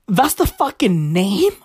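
usual speaking voice

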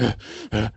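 low mock grunts; two short, low effort grunts; imitated grunts. Imitating wrestler in close-up while punching, low grunts. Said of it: "and he's like".

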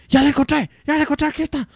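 high, loud shout of discovery; imitating a man yelling to the others; calling out. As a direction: announce the find at the top of the voice.

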